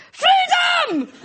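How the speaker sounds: rally the troops with a wild high cry; shouted battle cry. Retold or imitated.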